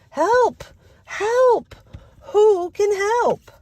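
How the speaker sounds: high, loud cry of help; calling for help. Acted. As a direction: squeal for help like a stuck duck. Duck calls for help in story.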